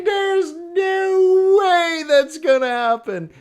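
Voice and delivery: very high, loud, drawn-out mock guffawing; hammed-up incredulous hilarity, words stretched; mocking laughter. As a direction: imitate scoffing Europeans cracking up.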